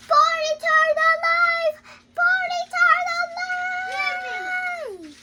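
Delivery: high, loud desperate shout; a child's full-voiced yell, high and loud; calling out. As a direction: cry it out with everything you've got as you run. Man runs off, calling out.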